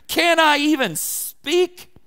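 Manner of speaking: loud, slow, wailing complaint; whiny protest. Acted. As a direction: cry out like a wronged kid brother. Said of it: "David sounds a little whiny".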